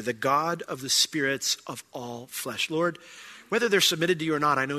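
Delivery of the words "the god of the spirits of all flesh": "the God of the spirits of all flesh" drawn out slowly; slow reverent emphasis. Real, not acted. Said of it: "I love the phrase here".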